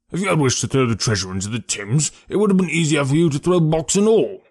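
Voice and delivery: stern argument climbing high. A narrator's reading. Detective picking holes in the prisoner's story, stern. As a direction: press the accusation, pitch rising. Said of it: "said Athelney Jones sternly".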